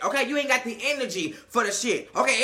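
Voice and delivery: ordinary talk, nothing marked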